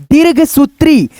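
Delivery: ordinary talk, nothing marked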